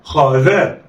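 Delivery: خا ذا snatched together; blended quickly. Shown as a demonstration. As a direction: run خا and ذا into one. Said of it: "اخطف".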